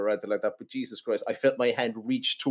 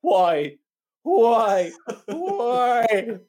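high, loud, drawn-out wailing; exaggerated anguished death cry; mock dying wail; 10 semitones higher, 5 dB louder, much slower than usual. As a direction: wail it higher each time, hamming it up. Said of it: "young fella sits up and goes".

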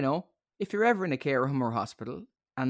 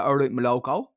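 put-on Dublin accent; 5 dB louder than usual. Podcaster mimicking a Dubliner greeting the band, Dublin accent. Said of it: "if you're from Dublin".

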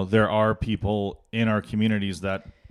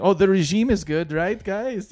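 put-on Chinese accent; 10 semitones higher than usual. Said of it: "That was my Chinese".

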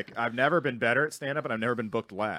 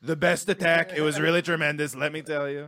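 louder, higher boastful politician; Trump; 3 semitones higher, 3 dB louder than usual. Acted. Comic quoting his old bit, boastful president impression. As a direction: brag it out, loud and self-important. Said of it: "it would be like Trump would say".

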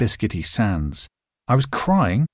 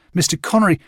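first call after a man walking away, raised; 7 semitones higher, faster than usual. A narrator's reading. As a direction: call out to get his attention. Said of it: "I called to him".

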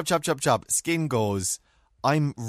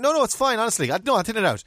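polite protest; 7 semitones higher, 3 dB louder, faster than usual. Retold or imitated. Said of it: "And I was like".

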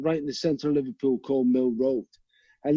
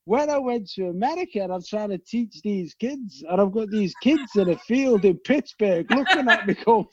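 ordinary talk, nothing marked

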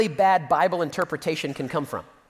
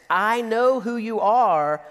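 slow, drawn-out, higher cry of recognition; demon's outcry; 4 semitones higher, slower than usual. Acted. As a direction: play the demon calling out slowly. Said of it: "look what he says next".

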